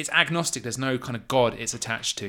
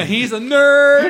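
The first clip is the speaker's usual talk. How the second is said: nerdy squeal; 11 semitones higher, 9 dB louder, much slower than usual. Pretend. Mocking nerd squeal.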